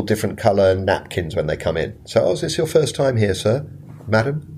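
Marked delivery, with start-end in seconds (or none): 2.2-4.6 higher-pitched polite host welcome; lightly acted, lilting front-of-house greeting; restaurant host greeting. Host asking arriving guests about their visit, polite higher-pitched greeting.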